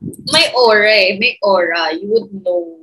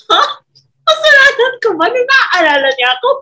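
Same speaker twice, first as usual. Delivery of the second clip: exasperated; 13 semitones higher, 6 dB louder than usual. Retold or imitated. Retold friend's jealous complaint.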